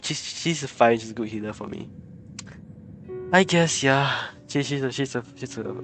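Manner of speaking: usual speaking voice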